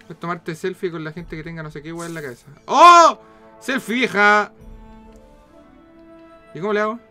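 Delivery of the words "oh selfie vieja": high loud surprised oh; surprised shout. Real, not acted. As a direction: gasp out a shout. Surprised exclamation in game.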